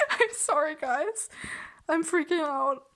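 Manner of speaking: high, loud and laughing; freaking out. Real, not acted. Vlogger apologizing to viewers, freaking out. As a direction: squeal an apology through giddy excitement.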